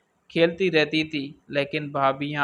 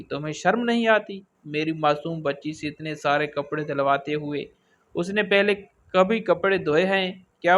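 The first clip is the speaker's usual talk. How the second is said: usual speaking voice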